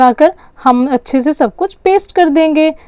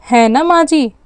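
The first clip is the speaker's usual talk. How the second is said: usual speaking voice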